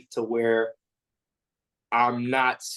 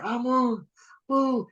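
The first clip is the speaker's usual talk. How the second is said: hyped walkout whoop; 12 semitones higher, much slower than usual. Retold or imitated. Coach picturing the walkout, pumped-up whoop. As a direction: jump way up in pitch for a whooping woo.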